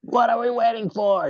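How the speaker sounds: high-pitched imitation of a film trainer; excited mock coach rallying cry; rousing trainer. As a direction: belt out the movie catchphrase up high. Quotes film trainer's rallying line.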